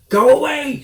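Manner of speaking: high, loud squeaky shriek; shrieking Rat. A narrator's reading. Rat shrieks at Mister Fox to leave. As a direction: screech like an outraged rat. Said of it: "shrieked Rat".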